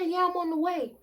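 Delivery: high-pitched mock-irate grandma; imitating furious grandma. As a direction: play an angry grandma confirming a threat. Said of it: "losing her mind on me on my phone".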